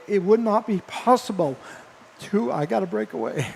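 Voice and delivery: real emotion breaking into the words; choked up. Real, not acted. Broadcaster thanking players' families at season's end, choked up. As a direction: voice giving way, needing to stop. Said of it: "I apologize for getting emotional like that".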